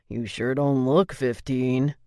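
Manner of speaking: slow sleazy smirk. A narrator's reading. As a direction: leer through the words. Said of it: "with a smirk he added".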